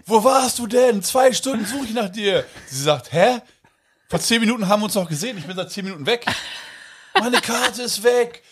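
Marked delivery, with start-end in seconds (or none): 0.1-2.4 high-pitched accusing yell. Retold or imitated. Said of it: "brüll sie da an". 3.1-8.4 put-on annoyed girlfriend. Girlfriend's retort voiced.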